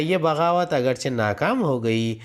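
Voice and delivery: ordinary delivery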